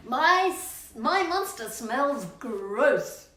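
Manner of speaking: slow dramatic title; story title announcement. Acted. Reads title to start story.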